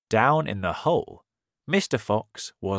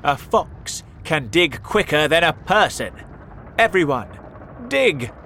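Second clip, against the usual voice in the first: higher, louder commands; rallying shout; 7 semitones higher, 8 dB louder than usual. A narrator's reading. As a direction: rouse the family to dig. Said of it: "shouted Mister Fox".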